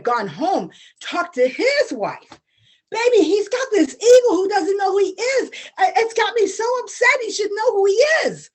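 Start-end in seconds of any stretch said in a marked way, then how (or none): 2.9-8.5 upset whining. Acted. Brother complains to wife.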